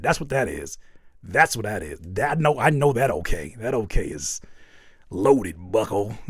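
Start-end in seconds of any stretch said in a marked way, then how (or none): 3.6-6.2 slow mock suspicion. Mock suspicious reading of emoji.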